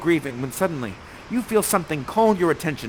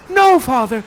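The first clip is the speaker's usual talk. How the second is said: much higher and louder; Miranda pleading; 11 semitones higher, 10 dB louder than usual. Acted. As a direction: defend him to her father. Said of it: "She stands in between ... and says".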